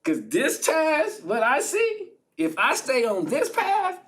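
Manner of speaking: high strained pleading with laughter; beg, voice pushed up high; mock desperate prayer to Father. Jesus praying in Gethsemane.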